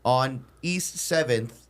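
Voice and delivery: drawn out, word by word; slow careful directions. Retold or imitated. Customer directing a driver who speaks little English, slow and deliberate. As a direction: spell it out slowly with pauses.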